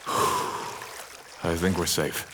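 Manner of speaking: slow breathy whisper after a sigh; relieved whisper. Acted.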